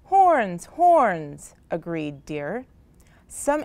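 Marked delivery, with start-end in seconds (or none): 0.0-1.5 loud, falling holler; hearty animal holler. A narrator's reading. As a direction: holler it like a rallying cry. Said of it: "hollered Rhino".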